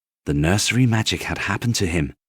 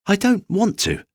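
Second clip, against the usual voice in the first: high, stubborn little voice; little rabbit refusing; 14 semitones higher than usual. Acted. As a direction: dig in your heels. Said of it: "he said again".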